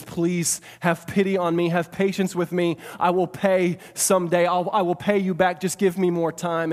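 mildly urgent plea, preacher-voiced; begging. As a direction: plead the servant's case, lightly acted. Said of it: "he begs".

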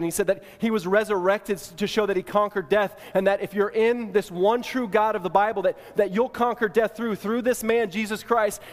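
preacher's building proclamation; rising preaching. Acted. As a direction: proclaim it with growing conviction. Gospel climax of sermon.

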